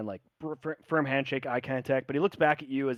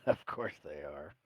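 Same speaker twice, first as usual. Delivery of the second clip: low chuckle through the words; old sailor chuckling; knowing laugh; 8 semitones lower, 5 dB quieter than usual. Acted.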